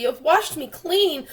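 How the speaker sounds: high, loud gleeful cries; overjoyed. Acted. The centipede cleaned by the rain, high joyful shouting. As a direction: crow with delight at being free.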